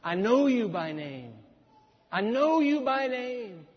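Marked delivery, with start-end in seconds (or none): 2.1-3.7 higher, slow and tender; tender God voice. Shown as a demonstration. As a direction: let God speak it gently to a friend. God's words repeated, softer emphasis.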